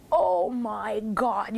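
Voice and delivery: very slow, awed oh my God; drawn-out stunned reverence; awestruck. Retold or imitated. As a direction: let it out in breathless wonder. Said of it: "it was like".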